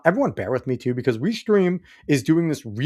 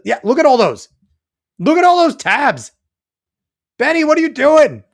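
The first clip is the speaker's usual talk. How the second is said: high, loud disbelieving shout at the tabs; loud, high cry of disbelief; incredulous shout; 13 semitones higher, 11 dB louder than usual. Real, not acted. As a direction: yell in amazed disbelief. Incredulous at all those tabs.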